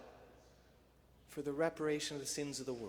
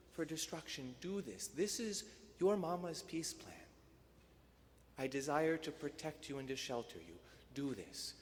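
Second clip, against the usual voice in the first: tender motherly plea; 5 dB quieter than usual. Retold or imitated. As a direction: plead gently like a loving mother. Mary's tender plea to her children.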